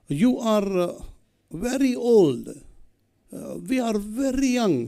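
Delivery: imitating a European accent, pitched high; high-pitched mock European English. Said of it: "in his typical European accent".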